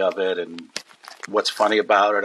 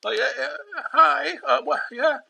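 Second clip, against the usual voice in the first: much higher flustered squeak; squeaky nervous teen. Retold or imitated. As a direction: stammer it out like a starstruck teenager. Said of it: "seventeen-year-old squeaky voice".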